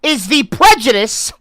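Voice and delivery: loud, high, over-the-top read-aloud; dramatic reading. Acted. Streamer reads article to chat in a booming dramatic voice. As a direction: declaim the article like a grand announcement.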